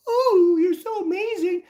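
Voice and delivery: high, loud, slow gushing diva voice; mocking diva. Scene: mocking a geneticist who went diva on him, high gushing voice. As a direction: ham up a fawning diva, drawn out. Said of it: "got all diva".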